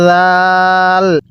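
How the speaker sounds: জাল with a soft z-like start, long-held aa. Shown as a demonstration. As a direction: ease into জাল and stretch it slowly. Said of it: "এরকমভাবে পড়ব". (জাল নরম করে টেনে)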